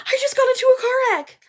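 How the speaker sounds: high, loud and rushed; panicked caller. Retold or imitated. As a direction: blurt it out high and fast in panic. Her panicked call to 911.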